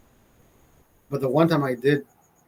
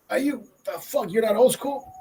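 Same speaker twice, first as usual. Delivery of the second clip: high, squeezed held-breath talk; mock held-breath voice; 7 semitones higher than usual. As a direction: imitate talking without letting the smoke out. Mimics talking while holding smoke.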